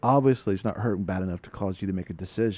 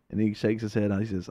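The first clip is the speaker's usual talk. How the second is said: ordinary talk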